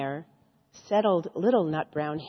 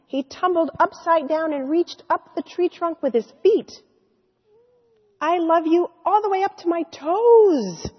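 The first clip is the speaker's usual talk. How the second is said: higher, louder, gleeful storytelling; excited; 8 semitones higher, 6 dB louder than usual. Acted. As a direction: bounce with the little hare's delight. Exuberant climax of the story.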